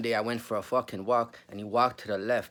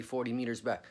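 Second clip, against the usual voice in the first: normal talking voice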